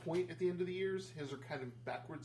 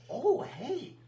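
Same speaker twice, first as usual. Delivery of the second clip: high surprised greeting; 11 semitones higher, 8 dB louder than usual. Acted. GM voices NPC greeting.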